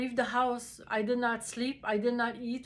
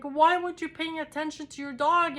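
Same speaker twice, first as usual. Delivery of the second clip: raised, higher, upset demand at the owner; upset accusation; 6 semitones higher, 5 dB louder than usual. Retold or imitated. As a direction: blame him in a loud, distraught burst. And I'm crying.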